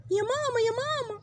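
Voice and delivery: put-on child calling. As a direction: call out for mum. Daughter calls her mother. (طفلة بتنادي على مامتها)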